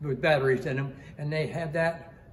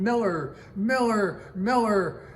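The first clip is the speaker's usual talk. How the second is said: raised, louder calling of a name; re-enacted yelling; 5 semitones higher, 5 dB louder, slower than usual. Crewman calling for shipmate lost overboard, retold yelling. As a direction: call out repeatedly for a missing man. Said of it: "And I'm yelling".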